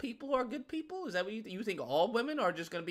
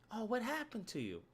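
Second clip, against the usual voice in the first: soft, cooing concern; mock sympathy; 10 dB quieter than usual. Onlooker asking the heartbroken what happened, mock sympathy. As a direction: coo softly at the wounded one.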